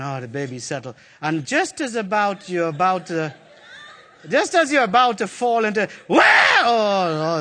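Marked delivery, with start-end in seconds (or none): 0.0-1.0 relieved mom. Retold or imitated. 6.1-7.4 startled. Retold or imitated. Baby wakes, startled.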